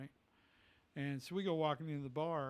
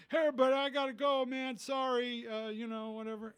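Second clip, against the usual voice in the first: high, loud, fake-casual brush-off; staged loud exit; 10 semitones higher, 8 dB louder than usual. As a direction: loudly play it up so the grifters hear. Scene: Attell excusing himself loudly.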